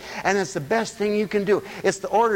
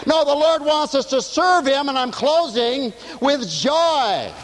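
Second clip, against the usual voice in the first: higher, louder preaching build; fervent preaching; 9 semitones higher, 4 dB louder, slower than usual. Real, not acted. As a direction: preach it out with fire. Preaching at full force.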